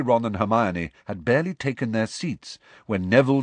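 usual speaking voice

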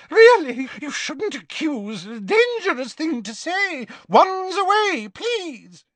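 a frightened seamstress pleading; squealing Madame Malkin. Acted.

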